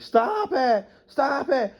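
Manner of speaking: hammed-up falsetto cry for help; mocking coward. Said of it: "he'd just stand there and be like".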